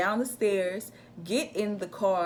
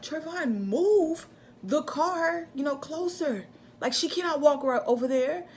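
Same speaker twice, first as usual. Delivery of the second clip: mom's higher, pressing voice, stretching out "move the car"; urgent mom; 8 semitones higher than usual. Retold or imitated. Mom urging Trayvon to move the car, urgent. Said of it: "my mom was like".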